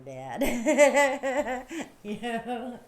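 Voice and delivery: helpless laugh breaking into the words. Real, not acted. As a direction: burst out laughing as you talk. Breaks up laughing after teasing dad.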